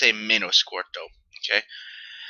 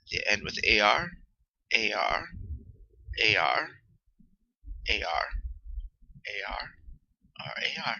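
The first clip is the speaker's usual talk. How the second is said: A R repeated, softer each time down to a whisper; fading repeated A R; 4 semitones lower, 9 dB quieter than usual. Shown as a demonstration. Teacher drilling the ending A R, fading to a whisper. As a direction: let each A R fade away to a whisper. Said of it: "notice with these words right here".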